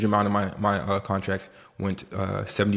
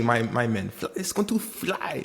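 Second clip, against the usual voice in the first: put-on playful Haitian accent; 3 dB quieter than usual. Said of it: "No, I'm just playing".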